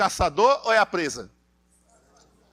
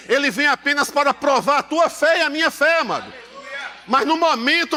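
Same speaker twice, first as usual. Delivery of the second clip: rising, louder pulpit cry; preaching build; 5 semitones higher, 3 dB louder than usual. Acted. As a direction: build the church up to the answer.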